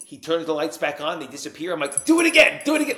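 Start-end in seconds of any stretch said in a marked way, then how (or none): 2.0-3.0 high, loud, thrilled plea; amazed excitement. Retold or imitated. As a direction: beg like someone wowed by a magic trick. Like it's a magic trick.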